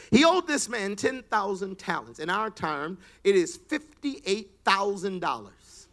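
loud, driving sermon reading of the parable; full-force preaching. Acted. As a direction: preach it out to the whole church. Preacher reads the parable aloud.

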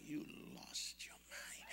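slow unvoiced whisper; hushed disbelief; whispered protest. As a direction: protest to God under the breath. Answering God inwardly.

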